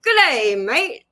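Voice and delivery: high, loud attempt at an Australian greeting; Australian accent. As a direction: try on a broad Australian accent. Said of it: "I could do a really good Australian".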